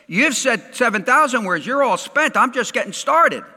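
high, loud, acted protest; animated mock spousal complaint; exasperated wife. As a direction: play a worn-out wife arguing back. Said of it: "And she's thinking".

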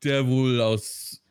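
very slow, drawn-out words with rising lilt; carefully drawn-out hint; coy hinting. Real, not acted. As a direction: stretch it out cagily, hinting without naming. Avoids naming a film title.

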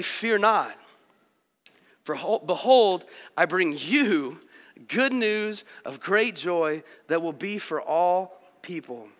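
scripture reading. Acted. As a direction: read the angel's words like a proclamation. Reads angel's announcement from scripture.

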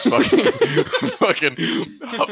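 words squeezed out through laughter; laughing. Real, not acted. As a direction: laugh as you say it. Laughing mid-sentence.

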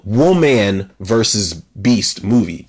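"woman" drawn out slowly, leaning on the word; stress on woman. Real, not acted. Reviewer flags the twist on man versus beast, stressing "woman". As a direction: put the emphasis on "woman", slowing down. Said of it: "I put the emphasis on that".

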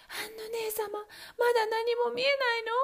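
high, quick anxious question; wife asking her sister again; wife calling. Acted. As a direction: ask urgently, voice up high.

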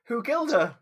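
high, loud, laughing disbelief; astonished shriek; shocked outburst. Real, not acted. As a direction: burst out with a loud, high question. Shocked by news of a death.

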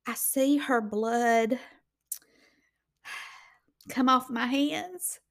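normal talking voice

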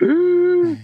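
very high squeaked ooh; a shocked high squeal; startled ooh. Retold or imitated. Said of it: "And I was like".

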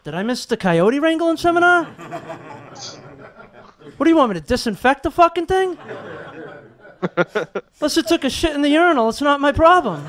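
high-pitched sarcastic protest; indignant griping. Acted. Janitor objects to coyote duty, indignant. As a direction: push back with mock exasperation. Griping back at radio call.

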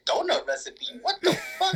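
high, laughing, can't believe it; laughing disbelief. Retold or imitated. Retelling reading the translation to a friend, laughing in high disbelief. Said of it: "I said".

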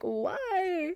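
high, drawn-out mock lament; mock wail. Looking back at letting him disrespect her, high mock wail. Exasperated at her past self.

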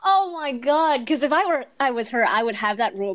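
normal talking voice

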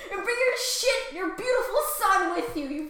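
a king still shouting in rage; angry yell. Acted. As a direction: keep yelling at him. Acted king yelling.

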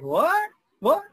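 high, sharp, disbelieving what; shocked what. Retold or imitated. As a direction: leaders hearing the old lady's demand, shocked and disbelieving. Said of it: "So people were like".

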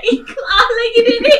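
shrieking laugh. Real, not acted. Wife cracking up at her husband's joke, shrieking laugh. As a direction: laugh hard, half shouting.

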